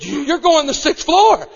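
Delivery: high, loud, final command to the son; mother's firm yell. Retold or imitated. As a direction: shout the order down at the boy. Mother laying down the law.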